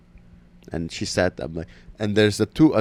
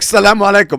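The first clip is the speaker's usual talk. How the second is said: loud, high farewell; retold loud send-off; emphatic sign-off; 11 semitones higher, 9 dB louder than usual. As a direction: call out the greeting to close the argument. Acted loud goodbye repeated.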